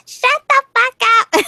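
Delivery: high, slow shrieked yell; squeaky child yelling; little girl yell. Retold or imitated. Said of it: "little girl just busts out with".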